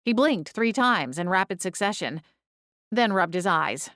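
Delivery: plain reading voice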